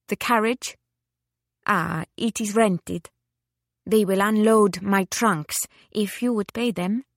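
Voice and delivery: put-on high-spirited Italian lady, lightly accented; Italian-accented lady, deliberate and imperious; Italian widow.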